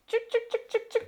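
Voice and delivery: high, bright chuk chuk call to chicks; calling chickens. Real, not acted. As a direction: call the chicks to their treats. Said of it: "let me show you guys what they do".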